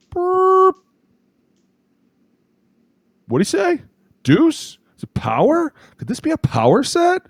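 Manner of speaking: very high squeaky bewildered voice; mock confused defender. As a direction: play the clueless defender, squeaky and lost.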